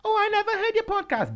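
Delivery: very high whiny mocking voice; mocking listener. Co-host mocks lazy listeners in a squeaky high voice. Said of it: "don't tell me".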